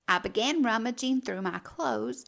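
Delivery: usual speaking voice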